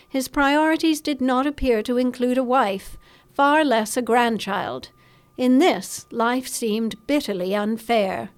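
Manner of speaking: plain reading voice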